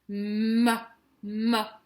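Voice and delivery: "Ma, ma" with the m held; drawn-out "mmma", repeated so the m is heard; sounding out the syllable. Shown as a demonstration. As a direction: hum the first consonant of "Ma" before opening to a. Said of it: "Qu'est-ce qu'on entend dans ma?".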